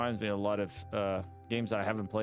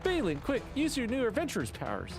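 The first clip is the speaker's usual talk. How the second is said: higher, hurried urging; urgent villager; 11 semitones higher than usual. Acted. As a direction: push the hero to act.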